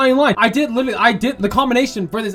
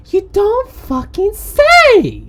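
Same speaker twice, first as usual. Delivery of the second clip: slow, high, drawn-out sarcasm; sarcastic exclamation; 11 semitones higher, 3 dB louder, much slower than usual. Acted. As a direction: stretch it out in mock amazement. Sarcastic reply to obvious remark.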